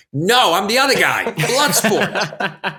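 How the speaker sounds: high-pitched comic protest; exasperated retelling, breaking into laughter; mock exasperation. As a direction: correct a friend in playful exasperation. Retold comic correction of friend's mix-up.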